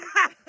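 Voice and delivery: short high laugh; quick high-pitched burst of laughter. Real, not acted. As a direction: crack up mid-song. Laughs mid-song.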